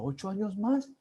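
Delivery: can't believe what he just heard; surprised echo. Retold or imitated. Echoing the Indian colleague's plan, incredulous.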